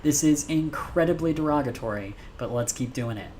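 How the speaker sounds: ordinary delivery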